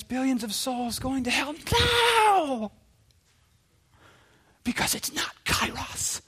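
high, strained whisper-scream with a drawn-out "now"; imitated screaming. Preacher imitating a missionary urging urgency, strangled screaming. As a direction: scream it under restraint, dropping into a voiceless rasp. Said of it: "I remember Jackie screaming into the microphone".

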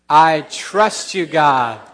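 slow, loud call to say it together; loud unison declaration. Shown as a demonstration. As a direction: lead the room in saying it out loud.

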